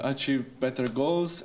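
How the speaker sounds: usual speaking voice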